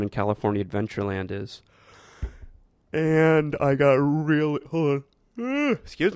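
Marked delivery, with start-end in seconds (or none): 2.9-6.1 words stretched through a real yawn; slow yawn swallowing the words. Real, not acted. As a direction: talk while yawning, then break off.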